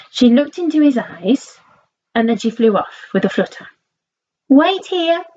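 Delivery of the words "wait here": higher calling out to the frog; cried out. Acted. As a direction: call out as she flies off. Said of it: "she cried".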